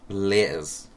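usual speaking voice

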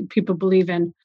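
ordinary talk, nothing marked

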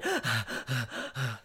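a small, quiet gasp of realization; soft gasp. Real, not acted. Realizing her dog's panting meant pain, a soft gasp. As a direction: catch your breath softly.

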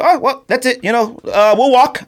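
high, breezy mock of his wife. Husband mocking his wife's breezy plan to walk, high and light. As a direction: toss it off lightly, like walking is easy. Wife's casual suggestion mocked.

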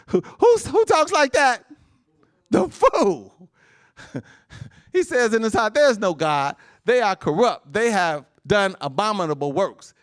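high, loud, mocking preacher's cry; preaching at full force. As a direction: thunder at the fool from the pulpit. Preacher rails against the fool.

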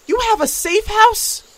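can't believe what she just heard; stunned squeak of disbelief; astonished. Acted. Shocked at the safe house.

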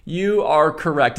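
emphatic host-style announcement; mock game-show host. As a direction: declare it grandly to a contestant. Mock host confirming the answer.

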